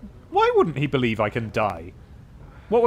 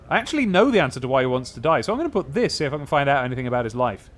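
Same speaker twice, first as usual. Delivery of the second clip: ordinary talk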